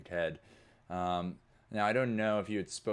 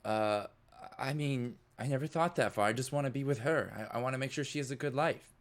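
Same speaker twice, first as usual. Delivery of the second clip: put-on higher, pleading boy voice; earnest young kid; 6 semitones higher than usual. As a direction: lovesick and unsure. Kid speaks of his love.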